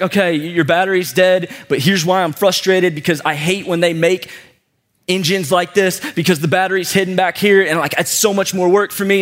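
ordinary talk, nothing marked

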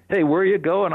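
a hint of a hearty trucker hollering hello; friendly farmer calling out. Farmer throws the truck door open to a hitchhiker, calling out high and loud.

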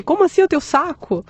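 high-pitched incredulous question; baffled disbelief. Retold or imitated. Patient reading her report, puzzled by a word, incredulous. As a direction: retell your bafflement, pitch jumping up. Retold confusion at test results.

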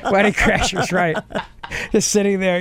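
ordinary talk

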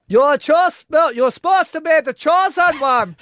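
high, loud anguished Jedi cry; Obi-Wan Kenobi. Acted. Co-host as Jedi talking to Anakin, anguished cry. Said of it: "Obi-Wan Kenobi".

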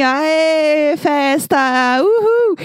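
cheering, ending in a high whoop; party cheer. Retold or imitated. Watching the Zoom party, cheering along, excited whoop. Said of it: "E eu tava só assim".

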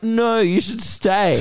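high, slow, drawn-out imitation; mock insistence. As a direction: mimic an over-insistent invitation, dragging it out. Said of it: "No one's ever been like".

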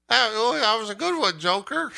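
high, chirpy mock praise; mocking imitation. Player imitating the character's tiresome praise, mocking imitation. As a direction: parrot the phrase in a high mocking voice. Mimics an annoying repeated game phrase.

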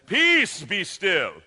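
booming theatrical proclamation, PEACE pitched high; loud, hammed-up heroic order; thespian voice. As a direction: command the storm like a stage actor. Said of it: "using the thespian voice".

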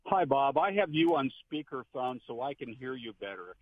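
ordinary talk

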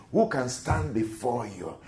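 usual speaking voice

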